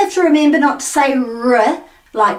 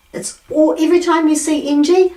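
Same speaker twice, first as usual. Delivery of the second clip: usual speaking voice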